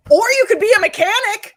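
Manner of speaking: mock parent. Asking comedians about their kids, mock parent suggestion. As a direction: playfully warn the kid off the business.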